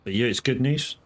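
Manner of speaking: ordinary talk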